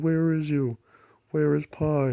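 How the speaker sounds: lightly voiced grandpa calling; searching grandpa. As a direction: call out looking for him.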